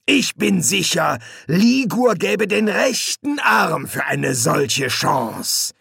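high, slow taunt; malicious gloating demon. Acted. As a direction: lay it on thickly and slowly. Hastur taunts Crowley.

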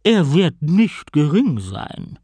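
mock the little tortoise, slowly; evil queen. A narrator's reading. Queen warns of price.

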